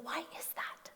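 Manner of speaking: whispered puzzled question; hushed, curious whisper. Retold or imitated. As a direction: breathe the question in wonder. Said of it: "And I'm always like".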